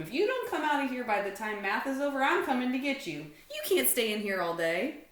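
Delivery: put-on fast, loud, high kid warning; Larry warning. A narrator's reading. Larry warning Stanley he'll come get him, fast and loud. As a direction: rush the threat out firmly.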